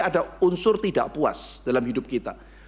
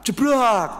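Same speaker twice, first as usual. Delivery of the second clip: loud, higher crash sound jebrak; crash noise jebrak; 7 semitones higher, 4 dB louder than usual. Retold or imitated. Preacher telling a car crash story, loud crash noise jebrak. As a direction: bark out jebrak like a sudden collision.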